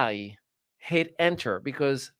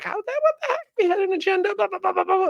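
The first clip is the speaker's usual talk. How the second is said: much higher, squeaky hammed-up rant; mock shrill rant; faster than usual. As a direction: screech it like a cartoon tantrum. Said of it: "I was like".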